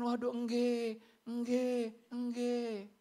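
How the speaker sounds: soft, deferential Javanese yes-yes; fake politeness. Person agreeing in front of others, falsely polite. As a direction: imitate someone over-politely agreeing. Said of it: "kita suka berpura-pura".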